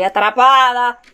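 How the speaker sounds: loud cry of being trapped; alarmed exclamation. Real, not acted. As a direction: raise the voice in distress.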